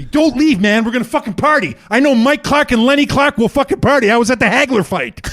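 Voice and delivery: high, loud party-guy babble; imitated overexcited fan; excited pleading. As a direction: beg him eagerly to stay and party. Birthday guy begging him to party.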